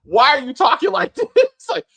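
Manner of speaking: high, loud, laughing protest; laughing exasperation. Real, not acted. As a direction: plead incredulously through laughter. Exasperated laughing at bad dialogue.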